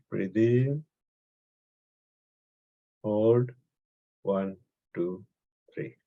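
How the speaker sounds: guided breathing. Acted. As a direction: count their breaths gently down to a near murmur. Leads breathing counts.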